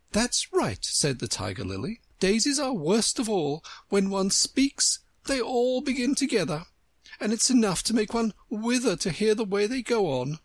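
lofty and superior; haughty flower. Acted. Tiger Lily voiced.

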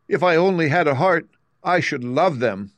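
a hint of longing for a heart; Tin Woodman wishing. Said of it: "added the Tin Woodman".

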